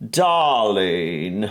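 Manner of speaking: exaggerated, slow upper-class British accent. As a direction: stretch it like a caricature British aristocrat. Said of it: "it's like the speech gets slower".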